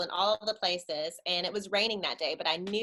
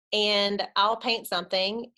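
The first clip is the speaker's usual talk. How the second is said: ordinary talk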